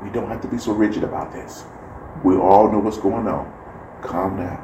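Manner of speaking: lightly voiced kind server, quiet and reassuring; calm reassurance. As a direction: gently talk someone down. Kitchen worker calms upset guest.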